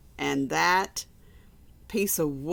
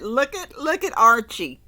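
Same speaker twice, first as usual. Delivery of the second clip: high, louder delighted look at this; excited pointing; 5 semitones higher, 8 dB louder than usual. Real, not acted. Reader excited over book picture.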